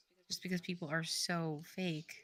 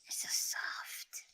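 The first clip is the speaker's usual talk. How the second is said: slow, hissing whisper; Gollum whisper; slower than usual. Acted. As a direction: creep it out like Gollum. Keeps doing Gollum.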